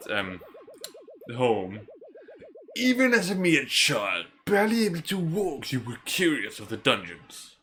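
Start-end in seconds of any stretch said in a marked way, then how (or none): 2.8-7.6 put-on theatrical old man recounting childhood; old mentor. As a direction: voice an old storyteller, pitch swinging high then settling. Reads old man's dialogue.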